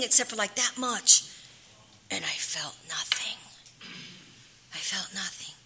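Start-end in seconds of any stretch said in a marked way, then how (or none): 2.1-5.5 whispered, hushed confession. Real, not acted. Recovering addict telling listeners she felt nothing, whispered. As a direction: breathe it out, barely voiced. Recalling drinking to no effect.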